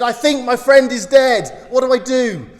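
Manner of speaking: high, loud, breathless panic; panicked caller. Retold or imitated. Hunter phoning the operator in a breathless panic. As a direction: gasp it out as if the friend just died. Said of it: "gasped out of breath".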